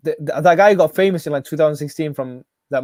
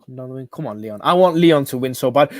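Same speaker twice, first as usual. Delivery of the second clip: usual speaking voice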